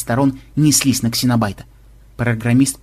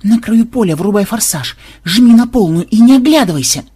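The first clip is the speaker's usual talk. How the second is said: stubborn hiss through teeth; 8 semitones higher, 6 dB louder than usual. A narrator's reading. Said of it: "упрямо сжав зубы, процедил Ксенобайт".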